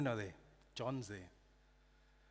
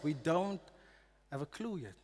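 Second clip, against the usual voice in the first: ordinary talk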